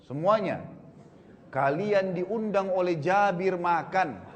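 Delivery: drawn-out call to a whole crowd; retold shouted announcement. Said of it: "lalu beliau teriak mengatakan".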